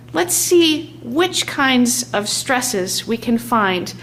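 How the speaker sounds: ordinary delivery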